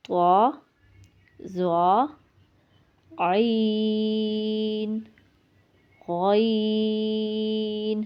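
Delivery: each letter drawn out slowly, one by one; reciting the alphabet. Shown as a demonstration. Teacher reciting letters for her students, slow and drawn out.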